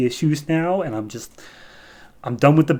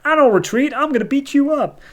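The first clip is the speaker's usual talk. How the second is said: much higher, louder demon bluster; hammed-up fighting talk, pitched way up; acted defiant threat; 13 semitones higher, 4 dB louder than usual. Demon refusing the Queen's retreat, hammed-up belligerence.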